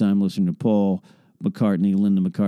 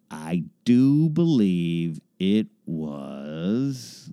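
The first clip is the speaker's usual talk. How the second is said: slow drawl; 6 semitones lower, much slower than usual. Acted. Slow drawn-out musing.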